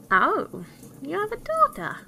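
high, eager dwarf exclamation; little dwarf piping up, loud and surprised; cried the dwarf. A narrator's reading. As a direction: shoot up high in keen interest. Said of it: "cried the yellow dwarf".

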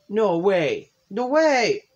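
high, loud excited disbelief; thrilled squeal. Acted. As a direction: squeal it with joy. Sayori thrilled he joined.

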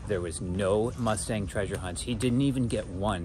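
usual speaking voice

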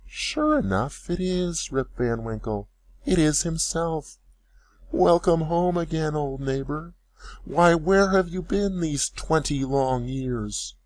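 put-on high, slow old woman's voice; old woman exclaiming. A narrator's reading. Old woman greeting her returned neighbor, amazed. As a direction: cry out in slow amazed recognition.

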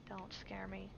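soft whispered don't scare me; whispered plea. Real, not acted. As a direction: breathe it out nervously. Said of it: "I hate jump scares".

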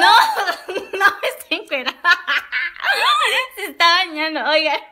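high, loud words through laughter; laughing as she corrects the others; laughing hard. Real, not acted. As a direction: protest while cracking up.

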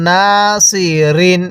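long held "না", then "সি, রিন"; drawn-out vowel. Shown as a demonstration. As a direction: stretch "না" before "সি, রিন". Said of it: "এরকমভাবে শিখব".

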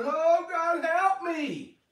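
high, hammed-up whiny plea; mock crying plea. Pastor showing what he is not doing, mock crying plea. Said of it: "So this isn't me crying".

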